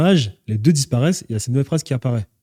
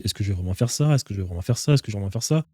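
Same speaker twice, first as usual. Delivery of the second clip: low, soft voice of inner doubt; hushed, repeated self-questioning; musing self-talk; 4 semitones lower, 5 dB quieter, faster than usual. Retold or imitated. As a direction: say it quietly, as if thinking to yourself. Voicing his own doubts to himself.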